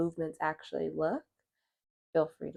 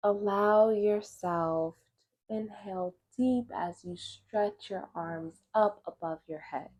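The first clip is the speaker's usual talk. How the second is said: guided meditation; 4 semitones higher than usual. Acted. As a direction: guide them gently through breath and stretch, unhurried.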